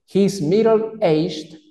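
deliberate word-by-word English reading; reading model answer. Shown as a demonstration. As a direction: stretch "middle-aged" out for learners. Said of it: "se las voy a leer".

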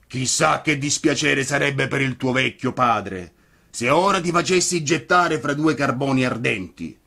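booming puppet master; gruff puppeteer. A narrator's reading. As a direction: play a softhearted blustering giant. Mangiafuoco's voice in dialogue.

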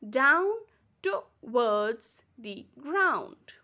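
Down, to, wards, the, ground, each a slow beat; split into syllables. Shown as a demonstration. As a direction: pause between beats, towards as to-wards. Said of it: "it breaks into two parts".

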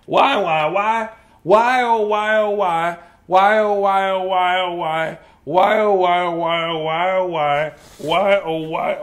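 loud, hammed-up wailing of why; wailing elephant. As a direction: moan each why to the sky. Gerald wails why repeatedly.

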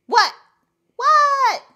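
high, loud "what?" hollered back; yelled reply. Acted. Froggy answers his mother's call, high yell.

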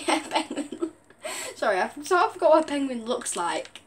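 real giggles breaking into the words; laughing. Real, not acted. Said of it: "I can't stop laughing".

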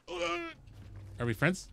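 very high, very slow sing-song; soft, stretched, pleading; coaxing. Real, not acted. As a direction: coo it like to a pet. Coaxing a wild creature.

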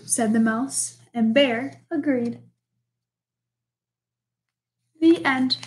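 put-on lively read-aloud for children; storybook reading. A narrator's reading. Teacher reading a picture book to the class, lively storytelling.

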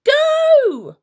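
very high, loud command; urgent shout. A narrator's reading. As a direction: yell at her to move. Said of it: "shouted Hamish again".

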